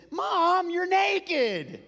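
high, loud mock alarm; fake shocked yell. Son pranking dad at mom's door, loud fake shock. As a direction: cry out in put-on horror.

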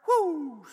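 high loud whoop; carefree whoop. Pretend. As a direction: whoop as the car flies off. Driver speeding off the bridge.

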